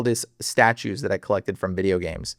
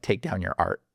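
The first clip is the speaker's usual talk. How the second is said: soft, low voice in his head; inner voice; 6 semitones lower, 7 dB quieter than usual. Retold or imitated. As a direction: say it like a quiet voice inside your head. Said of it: "a voice in my head that's like".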